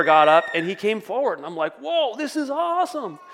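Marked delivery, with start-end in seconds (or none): 1.8-3.2 high-pitched awestruck exclamation; amazed delight. Retold or imitated. Preacher recalling his neighbor coming forward, amazed. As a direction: marvel in a high, stretched-out voice. Retells own delighted reaction.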